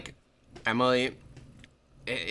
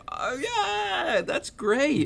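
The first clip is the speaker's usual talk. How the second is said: much higher, gushing, overdone cheer; fake enthusiasm; 13 semitones higher, 6 dB louder, slower than usual. [name] faking delight at her boyfriend's news, gushing high. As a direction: pretend to care, gushing sweetly. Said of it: "acting enthusiastic".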